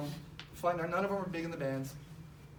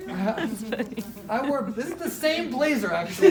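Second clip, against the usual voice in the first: loud, higher laughing aside; 4 semitones higher, 7 dB louder, slower than usual. Real, not acted. Pointing out his blazer to the audience, laughing. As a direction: laugh while admitting it.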